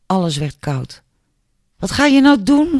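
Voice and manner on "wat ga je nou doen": high, loud, provoking question; taunting repeat. A narrator's reading. Albert presses Julia once more, loudly taunting. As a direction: push the challenge hard, much higher and louder. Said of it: "vroeg hij nog een keertje".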